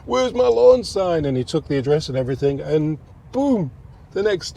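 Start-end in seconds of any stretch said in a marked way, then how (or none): 0.0-1.2 high, slow, pointed question; demanding question. Retold or imitated. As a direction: ask it like a demanding wife. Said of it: "said to him".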